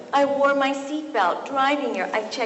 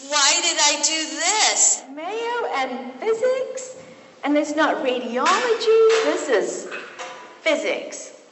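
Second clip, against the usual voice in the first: higher-pitched, drawn-out questioning; mock incredulity; 4 semitones higher, 4 dB louder, slower than usual. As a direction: play up the disbelief at your own unlikely choice. Voices disbelief at her own project.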